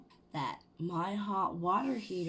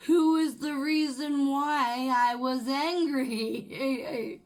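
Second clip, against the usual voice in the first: higher, louder, laughing through the words; real laughter breaking in; 7 semitones higher, 6 dB louder, slower than usual. Real, not acted. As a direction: crack up at the irony. Laughing at the irony.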